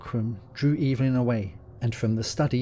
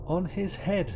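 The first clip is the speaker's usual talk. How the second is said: higher, softer echoing voice; ghostly echo; 7 semitones higher, 3 dB quieter than usual. A narrator's reading. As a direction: sound like the hall answering back. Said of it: "echoed the dim hall".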